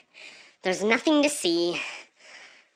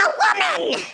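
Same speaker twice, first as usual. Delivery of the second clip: indignant shriek; 4 dB louder than usual. Acted. Woman correcting the driver, indignant shriek. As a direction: screech each word in offence. Outrage at being called bro.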